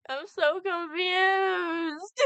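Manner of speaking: wailing. Acted. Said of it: "She wailed".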